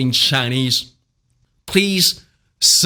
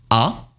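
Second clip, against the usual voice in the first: AH sliding upward; rising second tone; 4 semitones lower than usual. Shown as a demonstration. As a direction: lift the pitch through "ah". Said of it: "Second tone rising".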